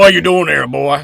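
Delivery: aggressive cop voice. Acted. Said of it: "I should be doing a voice for how aggressive".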